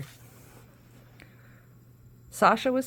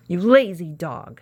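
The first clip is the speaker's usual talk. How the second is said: loud, slow angry shout; shouting witch; 8 dB louder, slower than usual. A narrator's reading. Witch shouting at the sleeping dog, loud angry. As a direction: bellow at the lazy dog. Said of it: "shouted Bony Legs".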